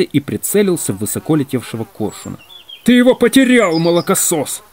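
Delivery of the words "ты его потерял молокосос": angry, high-pitched loud outburst; thunderous general's voice. A narrator's reading. General scolding Ваня over a lost item, thundering. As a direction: thunder at the culprit. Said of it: "раздался громовый голос генерала".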